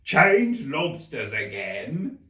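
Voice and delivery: loud, slow yell. A narrator's reading. The Gryphon yells the step, loud. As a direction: yell at the top of his voice. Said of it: "at the top of his voice".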